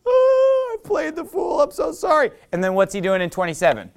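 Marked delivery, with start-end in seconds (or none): 0.0-2.3 high, wailing fake apology; hammed-up woe-is-me wail, pitched way up; mock pity party. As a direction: moan it in exaggerated self-pity. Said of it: "he has another pity party".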